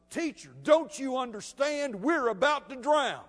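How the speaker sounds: loud, high-pitched panicked plea; desperate alarm. Acted. Apostles rousing Jesus in the sinking boat, desperate alarm. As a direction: cry out as if all hope is gone. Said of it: "they give up all hope, rouse him up".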